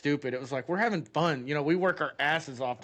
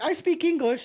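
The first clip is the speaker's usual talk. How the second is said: put-on girl's retort; 13 semitones higher, 6 dB louder than usual. The girl reveals she speaks English.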